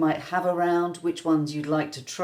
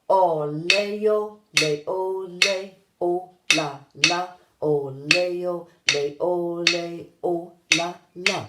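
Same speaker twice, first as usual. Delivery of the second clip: gradually speeding up; 4 dB louder than usual. Shown as a demonstration. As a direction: start measured and steadily tighten the beat. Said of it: "gradually speed up".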